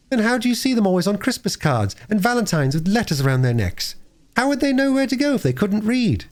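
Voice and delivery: put-on bright eager little girl; a lightly voiced excited young girl. Phyllis voiced.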